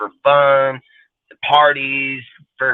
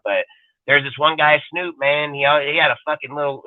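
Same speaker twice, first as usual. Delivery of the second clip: ordinary delivery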